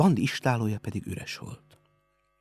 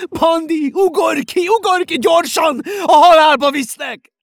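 very high, full-force desperate shouts; panicked yelling at the top of the voice; terrible screaming; 13 dB louder than usual. A narrator's reading. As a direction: scream a warning for someone's life. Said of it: "üvöltötte Rózsi rettenetes hangon".

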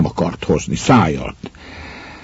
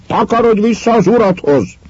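even higher, a sharp cry; retold shout; 14 semitones higher than usual. Miller sending Elena back to her husband, a high retold shout. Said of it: "kiáltott rá".

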